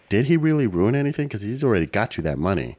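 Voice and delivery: usual speaking voice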